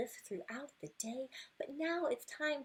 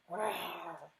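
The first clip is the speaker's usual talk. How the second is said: growling roar; 6 semitones lower than usual. Acted. Bulldozer's thunderous sound.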